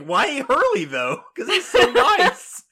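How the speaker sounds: high, loud, laughing disbelief; incredulous squeal. Real, not acted. Asking her cohost why Hurley, squealing incredulously.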